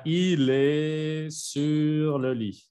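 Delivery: LIT drawn out slowly at the end; pronunciación modelo. Shown as a demonstration. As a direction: model "il est sur le lit" very slowly, word by word. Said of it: "Y aquí diría". (pronunciación francesa lenta, alargando "lit")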